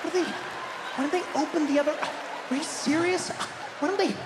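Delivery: high-pitched exasperated sputtering; hammed-up indignant shopper. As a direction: break off each question in mock disbelief.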